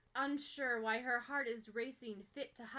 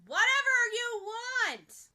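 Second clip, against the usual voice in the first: bellowing; 12 semitones higher, 13 dB louder, slower than usual. Acted. Bakugo still bellowing.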